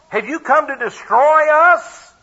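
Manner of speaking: hammed-up wail, high, loud and drawn out; moaning cry. Preacher acting the devil's complaint to Jesus, moaning cry. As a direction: groan and complain loudly, rising high to the end. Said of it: "cry out and complain and moan".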